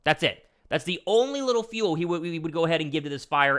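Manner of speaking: normal talking voice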